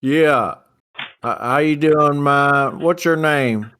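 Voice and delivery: loud, high farmer twang; country twang. Acted. Farm partner greets the dealer, loud country twang. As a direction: holler a greeting in a put-on twang. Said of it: "I can do a little twang".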